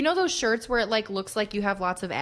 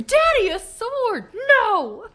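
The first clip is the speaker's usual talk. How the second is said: put-on much higher, slow gleeful child cry; excited kid; 14 semitones higher, 5 dB louder, much slower than usual. Picturing the girl showing dad the sword, high squeal. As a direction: squeal it like a thrilled little girl. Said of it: "That's all I'm picturing".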